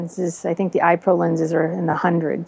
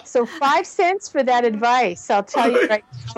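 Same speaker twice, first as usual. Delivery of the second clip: Lucy; 5 semitones higher than usual. Retold or imitated. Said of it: "I'm Lucy".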